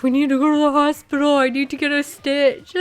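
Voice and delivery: high, loud retold panic; freaking out. Daughter demanding hospital for cut toe, retold freak-out. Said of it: "I was freaking out".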